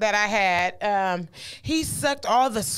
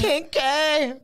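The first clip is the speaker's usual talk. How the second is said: KINKY pitched higher, put-on voice; teasing, higher second syllable on KINKY; playful emphasis; 4 semitones higher than usual. Real, not acted. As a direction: play up the word Kinky. Playful repeat of the word.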